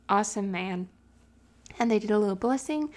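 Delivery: usual speaking voice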